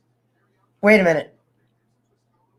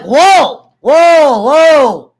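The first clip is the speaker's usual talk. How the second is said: louder, higher whoas, acted out; mock alarm; 6 semitones higher, 7 dB louder, much slower than usual. Telling how his buddy brought pills, mock-startled. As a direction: retell a startled reaction, punched up. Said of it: "I'm like".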